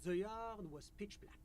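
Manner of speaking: put-on French-accented official; measured, polite French accent. GM voices official NPC.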